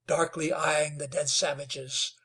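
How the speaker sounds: plain reading voice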